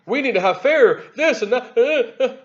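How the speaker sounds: high, stretched-out mocking impression, laughing at the end; imitating Mad Maxine. Mocking a congresswoman's speech to his audience, high and drawn out. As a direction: drag the words out high, then crack up laughing. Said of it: "when you hear Mad Maxine talk like that".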